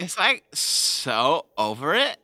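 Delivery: put-on slow, drawn-out Valley Girl; bored Valley Girl drawl. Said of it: "Valley Girl".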